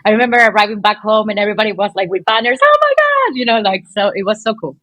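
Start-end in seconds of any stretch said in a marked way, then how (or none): 2.6-3.3 excited cry. Retold or imitated. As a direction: shriek it like a fan at the airport. Welcome home with banners.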